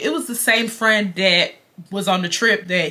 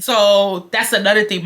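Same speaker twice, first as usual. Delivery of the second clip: ordinary delivery, nothing marked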